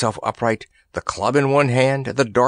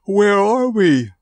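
high, loud, slow yawned question; sleepy boy yawning out a question; 10 semitones higher, 5 dB louder, slower than usual. A narrator's reading. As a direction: yawn the words out as he wakes. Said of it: "yawned Randy".

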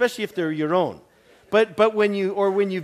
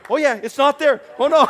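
amazed; 9 semitones higher, 10 dB louder than usual. Retold or imitated. He checks, amazed.

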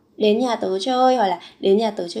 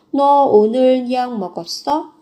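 ordinary talk

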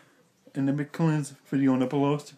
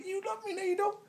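very high mock alarm at a scratching cat; startled at the cat; slower than usual. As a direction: back off from the cat, half joking. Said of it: "I'm like".

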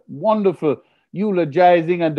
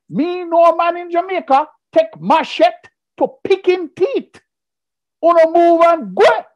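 much higher, louder, fiery Jamaican rant; vexed Jamaican patois; 13 semitones higher, 4 dB louder than usual. Retold or imitated. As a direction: let the Jamaican temper erupt like a volcano. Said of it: "I look at the man and I say".

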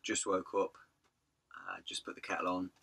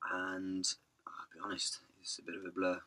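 whispering; 4 dB quieter than usual. Real, not acted. Quiet aside to camera.

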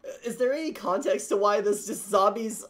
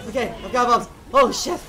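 ordinary delivery

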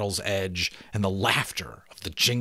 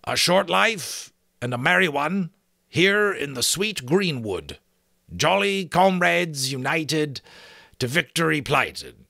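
higher, declaimed password reply; raised voice; 7 semitones higher, 4 dB louder than usual. Acted. Outlaw answering the sentry with the password, raised voice. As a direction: recite it out with a raised voice. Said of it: "raised his voice and answered".

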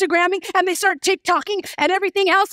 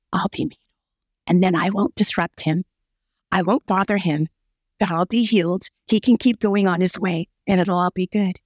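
lower, hushed inner voice of a desperate woman; voicing the bleeding woman; 8 semitones lower than usual. Preacher voicing the woman's secret thoughts, lower and hushed.

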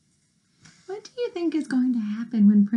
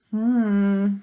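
usual speaking voice

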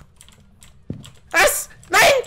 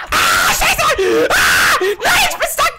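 shrieking high in real panic; panicked screaming; 14 semitones higher, 3 dB louder than usual. Real, not acted. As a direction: scream in terror, cornered. Stuck while ghost chases him.

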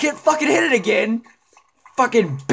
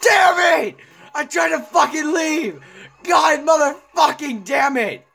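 high angry yelling; high frustrated gamer yell; furious shout; 5 semitones higher than usual. Real, not acted. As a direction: yell in frustration at being stuck. Gamer trapped, raging.

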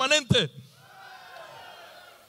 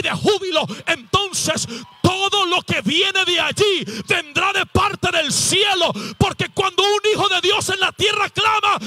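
impassioned, rising sermon crescendo; fervent preaching climax; 5 semitones higher, 4 dB louder than usual. Real, not acted. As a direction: preach up to a roaring peak. Sermon builds to full-force climax.

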